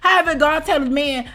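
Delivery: ordinary delivery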